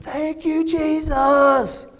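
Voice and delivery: high, bright-pitched excited cry; excited thanks. Retold or imitated. Kurt Warner after the Super Bowl, excited cry. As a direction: burst out thanking like a winner. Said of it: "because he was excited".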